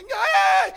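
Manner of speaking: put-on high, loud baby cry; newborn wail. Pastor acting the newborn refusing to open its eyes, loud high wail. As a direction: squeeze it out like a crying baby. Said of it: "കണ്ണ് രണ്ട് ഇറുക്കി പിടിച്ച്".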